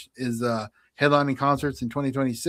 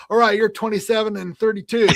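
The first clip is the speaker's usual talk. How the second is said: raised, louder mock quiz host; hammed-up scorekeeper, pitched up; random score announcing; 7 semitones higher, 5 dB louder than usual. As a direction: mimic your own score call, high and loud. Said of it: "the way I was saying it".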